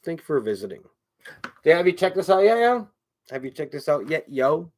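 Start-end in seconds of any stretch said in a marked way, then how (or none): 1.6-2.9 quick, higher, louder question; too quick. Real, not acted. Host asking viewers about his stuff, too quick. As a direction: rattle it out with raised pitch. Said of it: "I need to talk slower".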